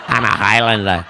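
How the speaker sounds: low, slurred Scottish star voice; mock film star, pitched well down; same deep Scottish voice for every part; Sean Connery. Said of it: "when he was playing the Spaniard".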